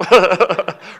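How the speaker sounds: short real burst of laughter; amused laugh. Real, not acted. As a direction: laugh out at the punchline. Speaker laughs at own story.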